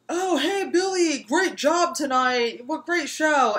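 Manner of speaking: high, sing-song imitation of the kids cheering; mocking fake cheer. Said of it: "the other kids were like".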